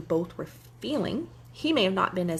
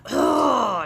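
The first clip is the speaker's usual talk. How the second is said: loud, higher exasperated ugh, imitating him; mock groan of a fed-up boyfriend; frustrated ugh; 5 semitones higher, 8 dB louder than usual. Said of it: "And he'd go".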